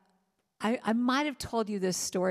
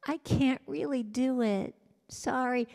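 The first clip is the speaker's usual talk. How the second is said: lightly voiced small child, higher; a hint of a sheepish little girl, pitched up; like a little kid; 5 semitones higher than usual. Asking the test-desk woman for help, lightly childlike and higher. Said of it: "I must have looked like I was ten years old".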